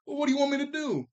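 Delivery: bratty exasperated question; Angelica whining. Retold or imitated. Angelica asking Susie what to do, high bratty whine.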